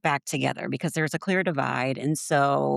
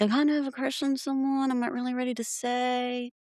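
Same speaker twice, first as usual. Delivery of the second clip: coy retold confession; 6 semitones higher than usual. Retelling a man's coy confession.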